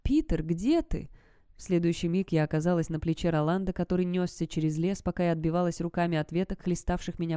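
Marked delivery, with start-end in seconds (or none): 0.0-1.1 high, slow eerie call; mimicked voice. A narrator's reading. Creature copies Sarah's call, high slow rising voice. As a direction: stretch the question out, rising at the end. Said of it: "пугающе идентичным моему".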